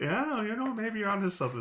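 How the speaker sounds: high, exaggerated American accent; put-on American accent. Early American agreeing to the new accent, put-on American accent. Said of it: "What if we started talking like this instead?".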